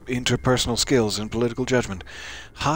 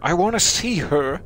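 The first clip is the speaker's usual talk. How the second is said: pitched-up, louder, overplayed plea; overdone grief; 6 semitones higher, 4 dB louder than usual. Shown as a demonstration. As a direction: overdo the grieving mother's plea. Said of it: "That wasn't a good emotional level".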